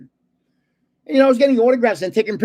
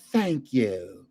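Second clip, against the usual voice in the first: put-on Southern accent; 5 semitones lower, 4 dB quieter than usual. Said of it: "in her little Southern accent".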